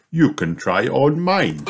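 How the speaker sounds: jolly dad chuckling through it; father teasing his kids with a laugh. Acted. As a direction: joke and chuckle at the end. Daddy jokes, laughter.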